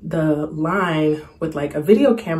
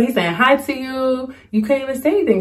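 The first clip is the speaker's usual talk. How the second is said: higher, lightly voiced teasing mom; scolding mom; 8 semitones higher than usual. A hint of mom chiding her shy daughter after the actor leaves. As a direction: tease her for freezing up. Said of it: "She's like".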